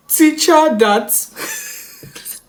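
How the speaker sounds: high, very slow hammed-up sob; stuttering mock cry. Crying child tries to explain to mom, hammed-up stuttering sob. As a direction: drag the words out through fake tears. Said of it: "that's literally how they typed it".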